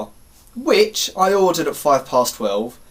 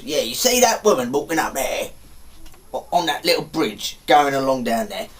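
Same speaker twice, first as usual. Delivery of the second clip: put-on local bloke; a hint of a character voice; lightly voiced bloke. Bloke pointing a stranger towards the bridge, lightly put-on voice. Acts out the bloke giving directions.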